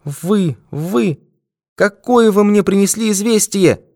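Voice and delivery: high-pitched, horror-struck woman; very high, stricken exclamations; horrified. A narrator's reading. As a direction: cry out in horror at the one facing you. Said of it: "в ужасе посмотрела на него".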